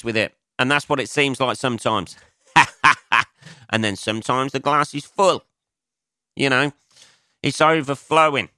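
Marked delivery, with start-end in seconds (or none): 2.5-3.2 short laugh. Real, not acted. Laughs at own joke.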